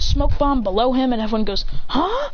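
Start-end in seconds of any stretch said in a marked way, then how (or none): 1.9-2.3 high rising gasp of a question; startled crowd. Acted. Everyone sees a smoke bomb, high-pitched surprise. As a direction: react as the baffled onlookers.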